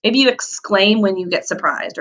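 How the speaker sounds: ordinary delivery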